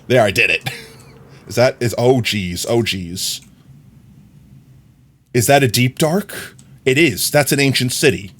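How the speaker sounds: ordinary talk, nothing marked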